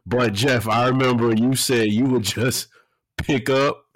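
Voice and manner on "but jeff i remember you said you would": ordinary delivery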